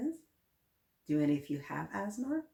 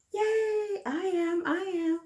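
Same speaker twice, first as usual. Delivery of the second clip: put-on high, loud cheering for the kids; bright kid cheer to the class; excited cheer; 13 semitones higher, 6 dB louder, slower than usual. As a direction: cheer like a happy child.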